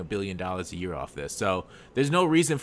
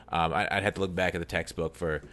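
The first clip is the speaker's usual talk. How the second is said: ordinary talk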